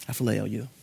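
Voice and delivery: a broken Peter admitting his limits; humbled Peter. Acted. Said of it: "Jesus meets Peter exactly where he's at".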